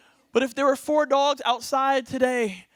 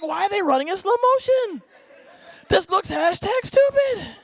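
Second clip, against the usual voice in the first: higher, louder scoffing onlooker; mocking onlooker; 8 semitones higher, 5 dB louder than usual. Onlooker from the second floor mocks the runner, higher and louder. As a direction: scoff like a watcher at a window. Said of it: "You're like".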